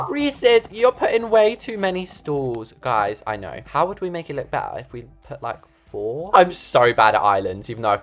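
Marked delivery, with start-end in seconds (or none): 0.1-2.7 high, mocking viewer voice; complaining commenter. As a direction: mimic a nagging commenter, high-pitched and drawn out. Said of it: "I can already see the comments saying".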